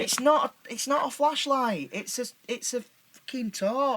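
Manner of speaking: high-pitched exasperated protest; pleading, exasperated correction. Acted. Correcting child's American word in exasperation.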